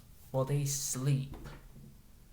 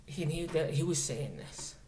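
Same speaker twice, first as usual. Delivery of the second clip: normal talking voice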